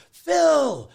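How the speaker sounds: long, high, loud call; hailing someone. Retold or imitated. Squash champion greeting him across the palace, hailing loudly. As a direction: call out the name in a drawn-out greeting.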